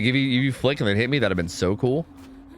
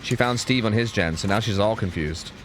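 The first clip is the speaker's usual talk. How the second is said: ordinary talk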